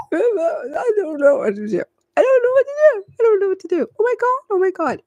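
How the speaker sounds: hammed-up high, tearful freak-out; mock panic. Reader acting out the client freaking out over a soulmate, mock panic. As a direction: squeal it over and over as if falling apart. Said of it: "this is the energy that you're get".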